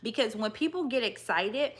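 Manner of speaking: ordinary delivery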